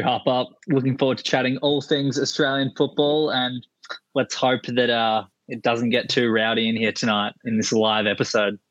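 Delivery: ordinary talk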